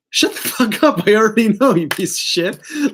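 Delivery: high, loud exasperated snap; mock furious retort. Reader voicing Aries snapping at the card, high and loud. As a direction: bite back like you're sick of hearing it.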